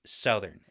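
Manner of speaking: "Southern" said in the speaker's own odd way; "Southern" with an off first vowel. Real, not acted. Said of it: "Say southern again".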